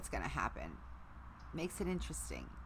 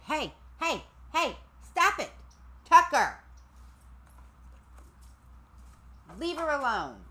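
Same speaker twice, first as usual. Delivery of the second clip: much higher, louder sharp scold; scolding the dog; 10 semitones higher, 5 dB louder than usual. Real, not acted. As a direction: call the dog off loudly, up high.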